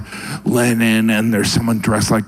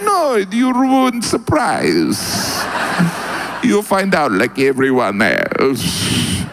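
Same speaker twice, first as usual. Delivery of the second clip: mock Russian; 5 semitones higher, slower than usual. Russians voiced, slow.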